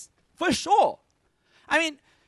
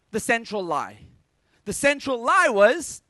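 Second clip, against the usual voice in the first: ordinary talk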